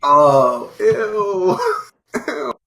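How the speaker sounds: high, loud drawn-out disgust; amused, high-pitched revulsion; grossed out. Real, not acted. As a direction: recoil in disgust with a laugh. Hearing a disgusting story.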